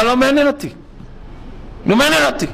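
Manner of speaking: high-pitched, curt brush-off; dismissive rebuff. Retold or imitated. Estranged son to the nurse about his father's death, dismissive rebuff. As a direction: act out a son refusing to care.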